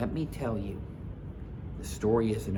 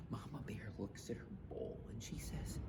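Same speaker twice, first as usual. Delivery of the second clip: whispered suspense; 17 dB quieter than usual. A narrator's reading. Narrator building suspense for listeners, whispered. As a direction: breathe it like a secret.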